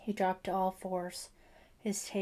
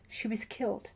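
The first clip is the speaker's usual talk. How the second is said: whispered; 2 semitones higher than usual. A narrator's reading. Father confessing wife's death.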